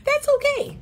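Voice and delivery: high, soft, sugary dismissal; sweet feminine brush-off. Retold or imitated. Coach voicing a sweet feminine brush-off, high and soft. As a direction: say it sweetly to put him in his place. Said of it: "nicest and most feminine way".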